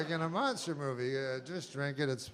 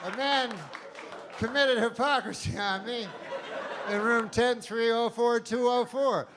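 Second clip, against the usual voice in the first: high, laughing call for help; a victim hamming up a high cry for help down the hotel hall; mock cry for help; 8 semitones higher than usual. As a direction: cry out to anyone nearby, half-laughing. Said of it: "I'll call for help".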